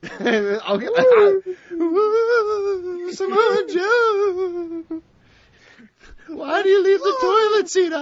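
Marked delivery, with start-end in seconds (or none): 1.7-8.0 hammed-up high voice. Comic put-on voice after recital.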